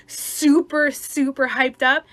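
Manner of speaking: loud, high and stretched out; hyped-up. Shown as a demonstration. Explaining overexcited people to listeners, hyped-up emphasis. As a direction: punch each "super" high and drag it. Demonstrating hyped-up energy while describing it.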